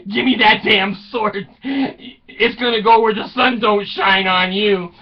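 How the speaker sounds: high, loud indignant retort; mock anger. Wife defying the flaming sword threat, mock-furious retort.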